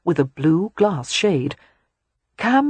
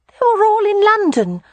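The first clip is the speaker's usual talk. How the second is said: put-on high, loud child voice; small boy objecting plaintively; protesting little boy; 5 dB louder than usual. A narrator's reading. Boy objects to his mother.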